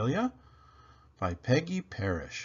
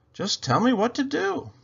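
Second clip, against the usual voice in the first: Amelia Bedelia voice; 7 dB louder than usual. Acted.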